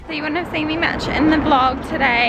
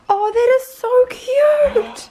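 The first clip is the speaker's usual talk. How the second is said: delighted squeal; 11 semitones higher, much slower than usual. Real, not acted. Seeing cute Airbnb room.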